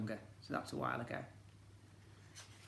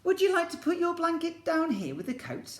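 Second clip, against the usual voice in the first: much higher, sing-song hostess voice; Bernard's mum asking; 7 dB louder than usual. Acted. Bernard's mum asks Alfie to put his blanket down, sing-song. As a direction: ask sweetly as a hostess.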